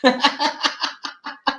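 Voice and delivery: soft, staccato laugh; chuckle. Real, not acted. Host laughing about the fireworks, soft chuckle. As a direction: chuckle quietly in a run.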